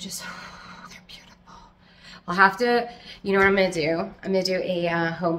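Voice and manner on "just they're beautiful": sigh, then hushed whisper; awed whisper. Real, not acted. Daughter admiring her mom's alocasia, awed whisper. Gushing over mom's plants.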